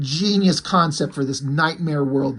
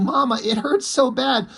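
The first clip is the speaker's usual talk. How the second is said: high-pitched mock child's cry of pain; hammed-up child's scream, pitched high; 8 semitones higher than usual. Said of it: "The kid is screaming".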